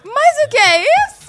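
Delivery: falsete agudo e espantado; espanto fingido. Pretend. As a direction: solte um espanto exagerado e agudo. Reação espantada, voz fingida aguda. (espanto fingido em falsete)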